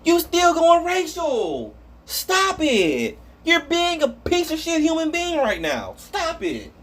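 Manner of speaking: scolding. Real, not acted. Scolding the commenter directly.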